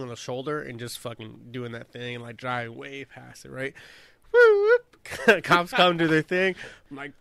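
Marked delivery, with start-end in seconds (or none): 4.3-5.4 high whooping siren noise; siren imitation.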